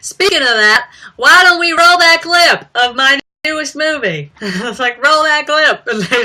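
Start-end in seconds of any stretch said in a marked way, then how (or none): 0.0-4.3 higher, louder hammed-up host; mock host. Vlogger imitating the host plugging her movie, louder. 5.0-5.9 higher, louder hammed-up host; mock host. Vlogger imitating the host plugging her movie, louder.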